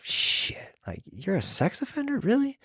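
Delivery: breathy hushed shock, rising high on really; mock stunned, voice jumping up at the end; stunned disbelief. Sergeant reacting to learning the inmate is a sex offender, stunned and hushed. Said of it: "instantly I'm like".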